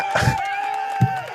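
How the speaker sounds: high, wheezing laugh bursting out; burst of laughter. Real, not acted. Commentator laughs off the Senate inquiry question, high burst of laughter.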